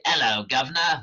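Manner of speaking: Cockney accent. Shown as a demonstration. Lecturer showing readers how Cockneys talk, Cockney accent. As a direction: put on a playful inner-city London Cockney accent. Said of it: "this accent here is Cockney".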